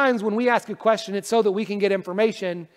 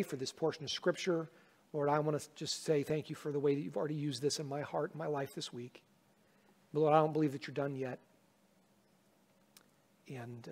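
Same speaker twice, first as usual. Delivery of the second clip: soft, hushed, earnest prayer; 6 semitones lower, 12 dB quieter than usual. Acted. Pastor praying aloud for help to preach, soft and earnest. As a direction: pray quietly before the congregation.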